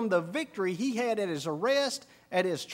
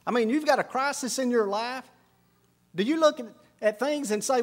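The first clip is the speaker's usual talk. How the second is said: raised, louder preaching voice, pressing the point; preaching at full force; 4 semitones higher, 4 dB louder than usual. Acted. Preacher pressing the congregation on prayer, raised and louder. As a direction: drive it home to the congregation, louder.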